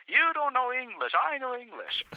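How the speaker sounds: the partner rubs it in with glee; teasing partner. Retold or imitated.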